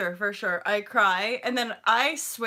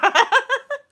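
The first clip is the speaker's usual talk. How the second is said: high, loud burst of laughter; 10 dB louder than usual. Real, not acted. Vlogger laughing at her own irony, high and loud. Laughs after the hardcore line.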